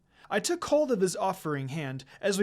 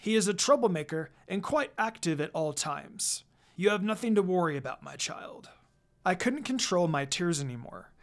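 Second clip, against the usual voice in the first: usual speaking voice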